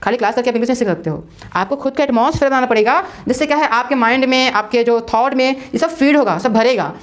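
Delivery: normal talking voice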